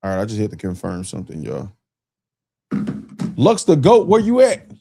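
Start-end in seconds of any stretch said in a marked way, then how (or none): 3.4-4.7 loud, high call; hollering for a viewer, Lux the Goat. Real, not acted. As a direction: holler for him. Calling the viewer once more.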